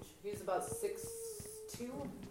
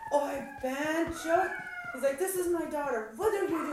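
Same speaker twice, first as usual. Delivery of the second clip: higher, alarmed protest; mock father-in-law shocked at the fight; father-in-law stepping in; 8 semitones higher, 3 dB louder than usual. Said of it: "And he's like".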